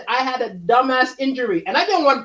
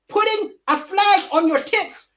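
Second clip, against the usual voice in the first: ordinary talk, nothing marked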